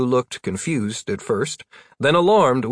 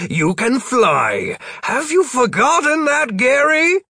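exasperated; 6 semitones higher, 4 dB louder than usual. Acted. Exasperated lecture building to high.

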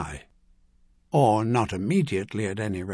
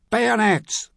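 high, loud yell; 10 semitones higher, 4 dB louder than usual. A narrator's reading. Officer ordering a bayonet charge, high yell. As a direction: cry the command out sharply. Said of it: "that voice yelled".

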